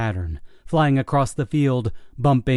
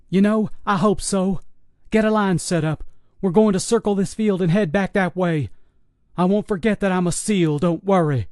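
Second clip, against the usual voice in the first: soldiers on the radio; 8 semitones higher than usual. Acted. Claire answers on the radio.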